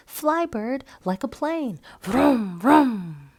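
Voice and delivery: high playful plane game; boy pretending a bird is a plane. A narrator's reading. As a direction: make the bird fly, vroom. Boy playing airplane with bird.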